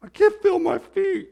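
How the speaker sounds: high-pitched mock complaining wife; imitating his wife. Husband imitates his bride complaining her feet hurt, high mock whine. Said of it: "She's like".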